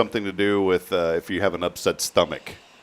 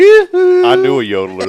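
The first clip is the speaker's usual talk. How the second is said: yodel-ish lilt; 13 dB louder, slower than usual. Acted. Yodeling topic, voice jumps.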